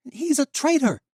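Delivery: high, loud accusing yell; a wounded boy yelling his hatred; angry shout. Acted. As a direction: spit it out as a furious boy. Jan shouting at the rescuers.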